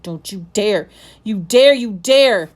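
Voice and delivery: furious repeated warning, rising loud; boy pinning his friend down, threatening; ground out between clenched teeth. Acted. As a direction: force it out in fury. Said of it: "he ground out between clenched teeth".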